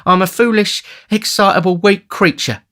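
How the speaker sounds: high, loud, fluttery lament; self-pitying lady. A narrator's reading. Matron to the attentive beadle, self-pitying. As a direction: bemoan yourself in a high lady's voice.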